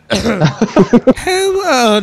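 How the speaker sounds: put-on high little baby hello. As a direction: put on a baby's voice. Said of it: "whip out your baby voice".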